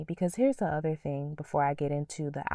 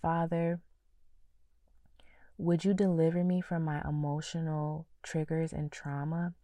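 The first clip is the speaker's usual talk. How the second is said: whispered prayer. Shown as a demonstration. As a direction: pray it in a whisper. Praying aloud again.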